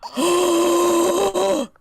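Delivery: loud, high shocked gasp. Real, not acted. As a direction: gasp in outrage.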